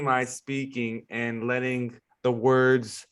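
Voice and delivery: slowed down, every word placed with care; slow it right down and land each word; careful, unhurried podcast delivery; deliberately slow. Shown as a demonstration. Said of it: "I'm trying to be really intentional right now with slowing".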